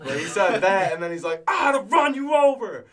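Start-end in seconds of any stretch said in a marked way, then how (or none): 1.5-2.8 high, loud imitation of a road-rage yell; retold angry yell. Bandmate acting out a driver threatening a woman, mock angry yell. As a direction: yell it like a furious driver, acted out. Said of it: "he's just, like, yelling at this woman".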